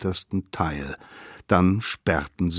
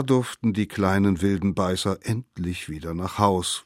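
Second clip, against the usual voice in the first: ordinary delivery, nothing marked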